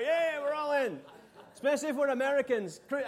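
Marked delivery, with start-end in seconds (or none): 0.0-1.0 loud, higher fired-up agreement; eager crowd cheer. Retold or imitated. Preacher plays the excited American listener, loud cheer. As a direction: cheer along like a hyped audience. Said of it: "I'm going for that part".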